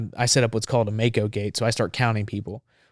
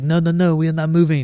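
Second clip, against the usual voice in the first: higher, louder refusal in mild earnest; retold alarmed refusal, pitched up; reporter's protest; 6 semitones higher, 5 dB louder than usual. French reporter refusing to move under fire, retold protest.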